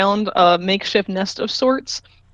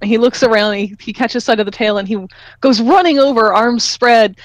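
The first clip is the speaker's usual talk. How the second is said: usual speaking voice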